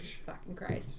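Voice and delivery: breathy exasperated whisper. Real, not acted. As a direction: whisper under your breath. Exasperated aside.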